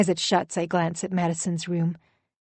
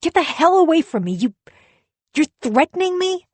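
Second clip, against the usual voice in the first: high, loud furious shout; 9 semitones higher, 7 dB louder than usual. Acted. Husband shouts at his wife to back off, furious yelling. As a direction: yell him off.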